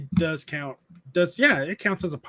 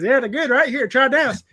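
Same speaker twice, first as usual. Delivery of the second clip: high, loud Southern uncle impression; imitated Southern uncle; 6 semitones higher, 5 dB louder than usual. Uncle urging nephew to try it, imitated Southern accent. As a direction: push the snack on them in a Southern accent. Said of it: "that's how he talks".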